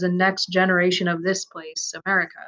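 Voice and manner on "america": ordinary delivery, nothing marked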